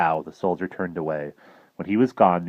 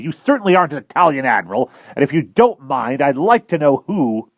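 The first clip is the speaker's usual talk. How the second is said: higher, louder cranky snap; crabby; 9 semitones higher, 8 dB louder than usual. Acted. Professor demanding answers from the admiral, crabby. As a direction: bristle with annoyance. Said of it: "he said crabbily".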